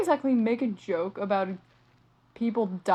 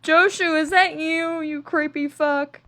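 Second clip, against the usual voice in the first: mock shout; 5 semitones higher, 9 dB louder than usual. Calling out to a creepy character.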